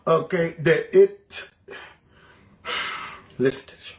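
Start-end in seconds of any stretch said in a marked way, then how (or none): 2.6-3.1 soft, heavy exhale; exasperated sigh. Real, not acted. Host fed up before replaying the clip, exasperated sigh. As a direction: let out a weary sigh.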